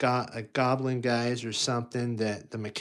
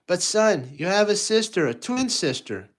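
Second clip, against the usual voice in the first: high, louder coaxing dad voice; Darth as patient dad; 8 semitones higher, 6 dB louder than usual. Acted. Darth telling Luke he has a twin sister, high coaxing. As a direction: gently insist like a father explaining. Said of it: "Darth's like".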